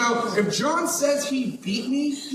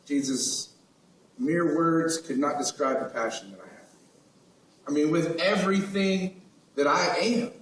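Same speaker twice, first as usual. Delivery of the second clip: lower, softer and tender; sincere confession; 5 semitones lower, 4 dB quieter than usual. Acted. Peter to Jesus, earnest and tender. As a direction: pour out heartfelt love quietly.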